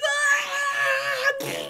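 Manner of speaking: long, very high mock scream; panicked scream. Boy realises he is under mistletoe, panicked scream. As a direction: shriek in comic panic, held long. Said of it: "And he just panics".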